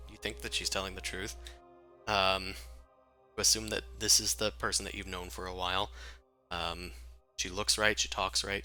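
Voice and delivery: ordinary talk, nothing marked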